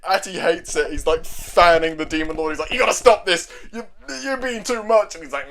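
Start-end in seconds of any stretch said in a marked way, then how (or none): 2.7-5.2 high-pitched mock exasperation; exasperated. Atty fans the Demon Lord, exasperated. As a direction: imitate a fed-up companion pleading. Exasperated character tells him to stop.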